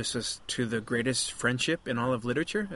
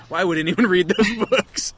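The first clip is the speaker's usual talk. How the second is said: higher, louder, laughing disbelief; retold exasperated wife; 5 semitones higher, 5 dB louder than usual. Husband retelling his wife's reaction to the book, mock exasperation. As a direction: imitate a baffled wife after a sigh. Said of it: "She says".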